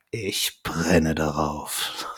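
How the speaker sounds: low, very slow, soft sinister purr; imitating Dracula. Dracula answering Van Helsing, low and slow. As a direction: savour each word like a villain. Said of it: "Und dann sagt Dracula".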